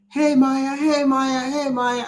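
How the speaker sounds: imitating fans calling out, high and singsong; high-pitched repeated greeting, mimicking passersby; crowd calling out. Traveler mimics Jamaicans greeting them on the street, high call-out. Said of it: "everybody's like".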